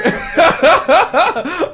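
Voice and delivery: high burst of laughing; loud laughter. Real, not acted. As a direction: crack up loudly. Laughing at snail joke.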